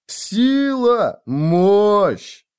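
high, whooping tipsy cheer; boisterous cheering. Acted. Drunken friend hails the champion, cheering boisterously. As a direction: belt out each word in triumph.

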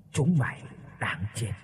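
low, hushed ghostly menace; quiet, chilling spirit voice; savage ghost. A narrator's reading. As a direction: pronounce a soft, deadly curse. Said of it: "nói một câu đầy man dợ".

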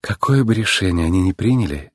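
low, gentle reassurance; tender. A narrator's reading. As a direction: soothe a husband lovingly. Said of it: "нежно сказала она".

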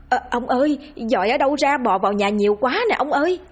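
high, fast cry for the husband; panicked cry. A narrator's reading. Wife seeing maggots all over the house, panicked cry. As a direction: call out in rushing panic. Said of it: "rồi hét lên lần nữa".

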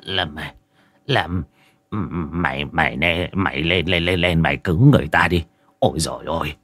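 low, soft, stammering urging; timid old man. A narrator's reading. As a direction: nervously nudge a friend to go first. Frightened old man urges his friend.

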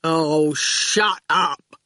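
very slow, placid, dim drawl; lazily amused henchman; slow lazy goon voice; grinning goon. A narrator's reading. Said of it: "Then he grinned".

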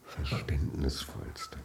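slow, low, soft sweet talk; lavish flattery. Acted. Husband sweet-talking his forgiving wife, slow soft flattery. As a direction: draw out every compliment, slowly and low.

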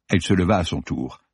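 ordinary delivery, nothing marked

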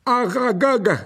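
high, loud calling cry from a giant spider; monster spider shout. Acted. Giant spider calls out to its master, loud and high. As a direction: bellow the name like a huge spider. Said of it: "cria le monstre".

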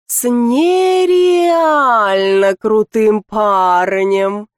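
drawling. Acted. Lika drawls about her boyfriend, slowly stretched. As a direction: drag out «С нереально крутым парнем» dreamily. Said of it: "тянет Лика".

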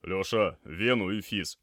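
loud, firm medical order; doctor's command. A narrator's reading. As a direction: give a quick order to an assistant. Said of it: "скомандовал доктор".

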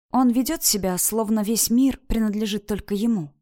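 ordinary delivery, nothing marked

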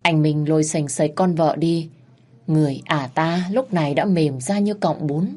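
ordinary delivery

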